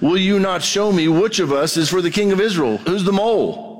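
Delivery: a hint of the anxious king of Syria questioning his servants; troubled king. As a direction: play the worried king demanding answers. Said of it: "he called his servants and said to them".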